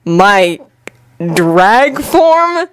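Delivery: halting, baffled question; pause, then lift the end in doubt; hesitant disbelief rising in pitch; uncertain. A narrator's reading. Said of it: "Sasuke repeated uncertainly".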